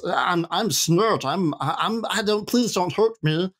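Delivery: high, pleading little goblin; funny goblin voice. Acted. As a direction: squeak out a halting, frightened plea. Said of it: "doing a funny voice".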